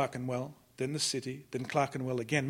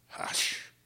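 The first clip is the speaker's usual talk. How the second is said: whispered hush. A narrator's reading. Narrator echoing Crofty's hush in a whisper. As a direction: breathe the word out with no voice.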